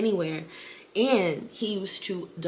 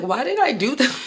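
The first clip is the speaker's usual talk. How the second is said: giggling panic, pitched up; laughing at herself; 6 semitones higher, 5 dB louder than usual. Retold or imitated. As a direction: laugh through the regret. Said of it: "I'm like".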